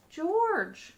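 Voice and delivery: a high, loud call across the street; man in the yellow hat calling up. Acted. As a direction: call his name from below. Friend calls to George.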